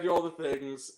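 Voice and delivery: ordinary talk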